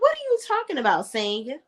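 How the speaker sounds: high, fast disbelieving question; incredulous. Retold or imitated. To Sanya about the alliance, high incredulous. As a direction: toss it off in quick disbelief.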